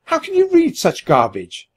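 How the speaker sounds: high-pitched indignant outburst; outraged squeak. A narrator's reading. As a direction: squeal it in disgusted disbelief. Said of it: "I squeaked".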